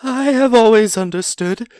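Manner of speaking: a tiny voice squeaking in fear; frightened squeak. Acted. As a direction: Bilbo daring a sly question while terrified. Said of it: "in a frightened squeak".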